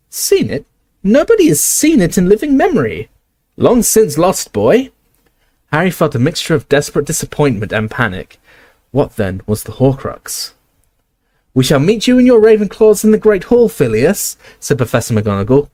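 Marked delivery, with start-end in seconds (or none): 0.1-4.9 very high, squeaky incredulous reply; squeaky Flitwick. Acted. Flitwick tells the boy it's long lost, very high. 11.5-14.4 high, crisp Scottish-tinged commanding voice; stern McGonagall. Acted. McGonagall directs Flitwick to the Great Hall.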